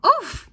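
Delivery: very high, loud grunt; the wind knocked out of you; winded oof. A narrator's reading. Mister Glutton falls on his wife and lets out an oof. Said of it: "he cried".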